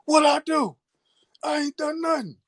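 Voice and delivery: shocked protest. Retold or imitated. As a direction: squeak out your innocence. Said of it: "I was in shock".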